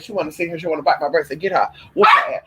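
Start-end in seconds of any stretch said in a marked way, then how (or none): 1.9-2.4 sudden high yelp of alarm; surprised shriek. Real, not acted. Startled owner yelps at the puppy, surprised shriek. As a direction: jolt up high and loud at the dog.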